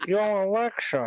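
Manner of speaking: high, slushy put-on voice; imitating Alexa user. Swearing someone really asked Alexa this, slurred imitation. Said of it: "someone said".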